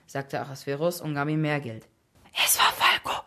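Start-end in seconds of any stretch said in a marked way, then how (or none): 2.4-3.2 loud stage whisper. A narrator's reading. Boy revealing the culprit to Ahasverus, whispering. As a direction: whisper the secret with full breath. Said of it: "flüsterte der Junge".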